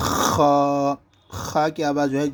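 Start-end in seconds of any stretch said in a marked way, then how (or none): 0.1-0.9 خا with a long rasping kh, vowel held level; throat-clearing خا. Shown as a demonstration. Teacher demonstrating the letter, خا rasped from the throat. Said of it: "کھکارنے کی جس طریقے پر آواز".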